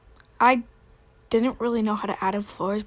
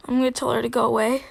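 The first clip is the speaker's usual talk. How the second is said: ordinary talk, nothing marked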